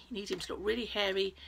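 ordinary delivery

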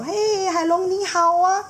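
high, slow congratulations; drawn-out friendly goodbye; cheerful seahorse dad. Acted. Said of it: "他就说".

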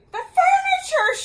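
very high, loud outcry; storybook shriek of alarm; dismayed cry. A narrator's reading. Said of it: "she cried".

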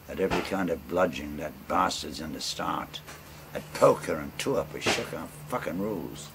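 rolling bush-poem recital, relishing every line; ballad recitation. Acted. Storyteller reciting a bawdy ballad to friends, in a rolling recital voice.

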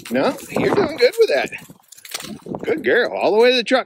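high encouraging pet voice; praising dog. Real, not acted.

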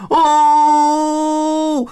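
high, loud whoop of a crowd of monkeys; monkey crowd cheer. Acted.

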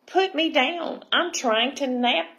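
lightly voiced tiny creature, pitched high; a hint of a small high voice from inside a shell; little voice. Tiny mermini protesting from inside the shell, little voice. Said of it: "I heard a little voice".

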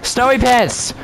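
high, drawn call after someone; playful high-pitched call; calling out a name. Pretend. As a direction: holler the nickname after a runaway. Calls after a runaway player.

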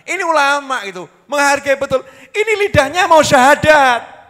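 full-force preacher at the peak; fiery preaching. Real, not acted. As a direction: lift the crowd with passion. Preaching climax, raised voice.

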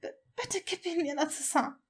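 high, soft, faltering plea; pleading. A narrator's reading. New mother asking Narcissa for her baby, pleading. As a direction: beg gently, tripping on the first word. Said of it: "ihre Stimme hatte etwas Flehendes".

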